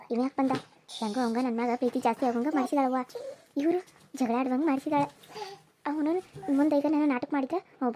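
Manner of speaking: low, hushed scheming aside; muttered aside. Acted. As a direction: plot under your breath so nobody hears. Aside to self, plotting.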